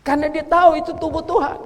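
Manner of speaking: high, loud shouted sermon; thundering sermon against the devil; fiery preaching. Real, not acted. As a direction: preach it with full force to the congregation. Preaching at full force.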